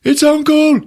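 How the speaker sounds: loud, high desperate yell to wake him; calling out. Retold or imitated. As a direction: shout at an unconscious friend to wake up. Calling out to unconscious friend.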